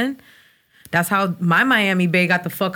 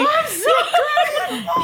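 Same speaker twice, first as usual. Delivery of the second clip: high shrieking laugh through the words, slow; shrieking with laughter; laughing shout; much slower than usual. Real, not acted. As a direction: howl it out while losing it. Hysterical laughter.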